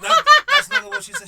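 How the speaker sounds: high, loud and fast through laughter; can barely get it out laughing; cracking up while telling it; laughing hard. Real, not acted.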